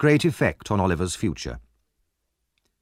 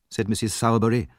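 ordinary delivery

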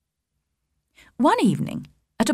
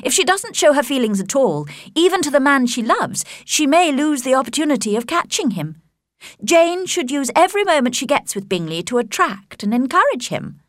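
higher-pitched, knowing friend; advising Charlotte; 6 semitones higher than usual. A narrator's reading. Charlotte advising Elizabeth on how Jane should act, knowingly. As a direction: press the advice with lively emphasis.

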